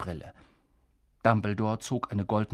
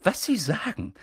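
pressing McGonagall; 9 semitones higher than usual. A narrator's reading.

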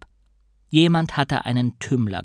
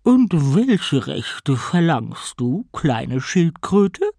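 slow, louder condescending queen; mock the little tortoise, slowly; evil queen; 3 semitones higher, 4 dB louder, much slower than usual. A narrator's reading. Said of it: "fragte die Königin des Meeres".

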